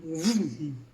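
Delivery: a quick car-like vroom; playful whoosh of a running kid; imitated vroom. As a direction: zoom the kid past with a vroom. Kid rushing past the man.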